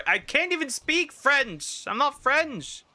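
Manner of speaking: very high, slow, squeaky put-on voice; silly squeaky voice. Answering chat's French request in a slow, high squeaky voice. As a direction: squeak it out like a cartoon mouse.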